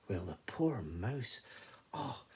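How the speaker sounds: ordinary delivery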